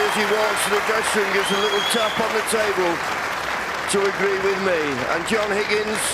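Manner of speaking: high, loud, rushing commentary; excited. Real, not acted. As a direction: call it like a thrilled commentator. Commentator thrilled after century.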